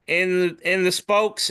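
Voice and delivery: usual speaking voice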